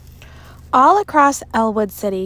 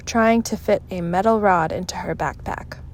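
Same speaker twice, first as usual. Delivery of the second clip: usual speaking voice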